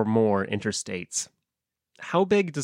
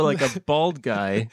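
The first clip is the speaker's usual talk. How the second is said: ordinary delivery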